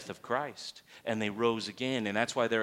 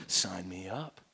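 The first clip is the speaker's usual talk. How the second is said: low, soft, decided aside; hushed eagerness; 7 semitones lower, 6 dB quieter, slower than usual. Acted. Preacher playing a newcomer joining the class, hushed eagerness. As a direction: drop low as if quietly sold. Acted learner commits, voice drops.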